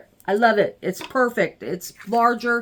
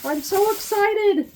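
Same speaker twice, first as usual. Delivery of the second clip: excited gush; 12 semitones higher than usual. Real, not acted. Unboxing her new light pad, a higher excited burst. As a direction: squeal it out with delight.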